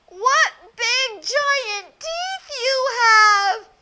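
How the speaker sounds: put-on a child marveling at something odd; wide-eyed little girl. Little girl marvels at grandma's big nose. Red's voice.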